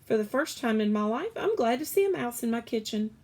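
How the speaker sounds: high, loud, fast and jolly; jolly cook. Acted. Cook glad to see a mouse, fast jolly voice. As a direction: gush it out quickly.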